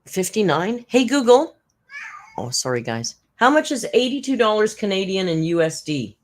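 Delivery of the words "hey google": calling out. Real, not acted. Calling the voice assistant.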